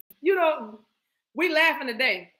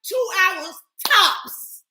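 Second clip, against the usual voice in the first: slow drawn-out pronouncement; 5 semitones higher, 3 dB louder, slower than usual. Acted. Mock spirit pronouncement.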